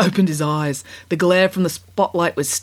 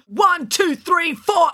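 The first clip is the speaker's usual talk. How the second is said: count-in shout; 12 semitones higher, much slower than usual. A narrator's reading. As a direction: count them in like a rocker. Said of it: "Kat counted them in".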